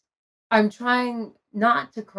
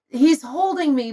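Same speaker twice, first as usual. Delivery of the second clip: normal talking voice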